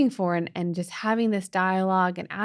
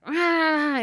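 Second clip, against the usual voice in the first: panicked yelp; 9 semitones higher, 4 dB louder than usual. Retold or imitated. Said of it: "went in and was like".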